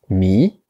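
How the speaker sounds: "mi" as a rising Mandarin second tone. Shown as a demonstration. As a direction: lift the pitch steadily through "mi". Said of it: "en levant la tête avec l'action de lever la tête".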